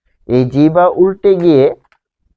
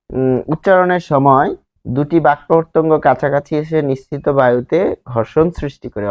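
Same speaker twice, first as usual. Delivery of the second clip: normal talking voice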